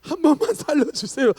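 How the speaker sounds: high, loud mock begging; hammed-up startled begging; frightened plea. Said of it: "아마 놀란 분들은".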